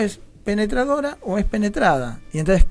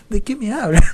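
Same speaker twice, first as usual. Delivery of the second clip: puzzled retort; 5 semitones higher, 4 dB louder than usual. Retold or imitated. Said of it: "yo decía".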